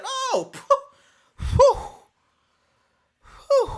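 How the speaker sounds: high, loud laughing sigh of relief; relieved laugh. Retold or imitated. Hearing it's just a drug test, laughing with relief. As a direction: laugh the worry away.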